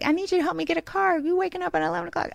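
high, fast mock whine; griping brother. Mocking brother griping at sister about sleeping late, high and fast. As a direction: mimic the brother nagging, high and quick. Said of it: "he's like".

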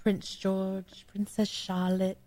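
put-on soft British accent; name them in refined British English. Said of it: "you could do the accent".

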